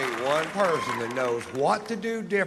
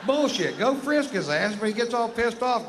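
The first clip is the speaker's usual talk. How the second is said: ranting yell; 9 semitones higher than usual. Acted. Comic rant builds into yelling.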